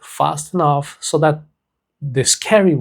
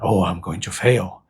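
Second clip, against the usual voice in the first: serious tone; 7 semitones lower than usual. Shown as a demonstration. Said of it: "in a serious voice tone".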